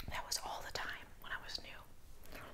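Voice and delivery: hushed confiding aside; whispering. Real, not acted. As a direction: whisper it to the camera.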